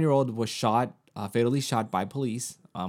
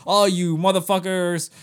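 angry all caps; 6 semitones higher, 9 dB louder than usual. Retold or imitated. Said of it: "put all caps".

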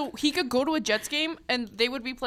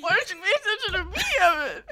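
high, raised mock-indignant demand; mock plea; 8 semitones higher, 4 dB louder, much faster than usual. Begging Evan for attention as a joke, mock-indignant. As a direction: playfully beg to be noticed.

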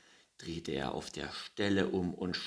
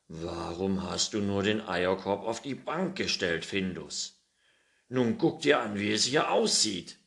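scolding; 3 semitones higher, 9 dB louder than usual. A narrator's reading. Pettersson scolds Findus over the eggs, loud scolding. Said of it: "schimpfte er".

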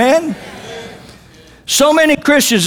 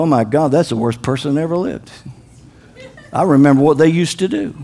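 low, softened disapproving remark; lightly acted gossiping churchgoer; mock judgmental aside; 8 semitones lower, 3 dB quieter, faster than usual. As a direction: act out judging someone under your breath. Acts churchgoer judging a newcomer.

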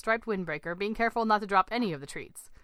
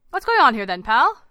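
very loud, confrontational challenge; aggressive; 4 semitones higher, 12 dB louder than usual. A narrator's reading. Swimmer confronting a man bothering his family, aggressive. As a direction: square up to him with a raised voice. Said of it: "said in a rather aggressive manner".

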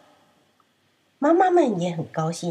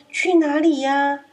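innocent lamb question; 6 semitones higher, 4 dB louder, slower than usual. Acted. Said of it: "羊咩咩问：".

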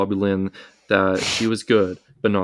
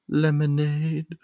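put-on slow, higher, drawn-out old lady voice; creepy old woman; 6 semitones higher, much slower than usual. S0 recapping the film, voicing the old woman offering lemonade, slow and high. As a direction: stretch it out like a creepy grandma.

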